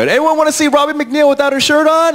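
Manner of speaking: retold hype-man challenge; calling to the crowd. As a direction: shout it out to a hall of fans.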